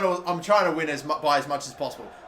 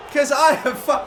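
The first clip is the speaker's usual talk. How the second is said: frustrated rant; 10 semitones higher, 5 dB louder than usual. Real, not acted.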